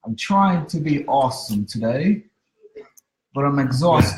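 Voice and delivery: bad British accent. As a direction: fumble through a fake British accent. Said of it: "Your accent is terrible".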